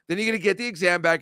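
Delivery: ordinary delivery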